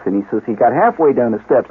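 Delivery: ordinary talk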